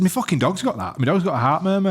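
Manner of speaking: high, animated outburst; excited retelling. Retold or imitated. Telling a pale friend his dog has the same thing, high and animated. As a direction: blurt it out, amazed.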